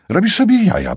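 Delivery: high, loud "robisz sobie jaja"; disbelieving outburst; disbelief. A narrator's reading. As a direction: blurt it in shock. Kat disbelieving.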